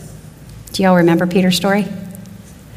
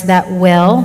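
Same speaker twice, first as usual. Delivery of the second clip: "will" drawn out slowly for emphasis; stress on WILL. Shown as a demonstration. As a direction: lean on the word "will" and stretch it. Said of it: "don't miss that word".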